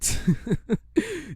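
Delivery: high put-on laugh at the runners; mocking laugh. Usain Bolt laughing at runners, a high mocking laugh. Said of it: "looking at them laughing like".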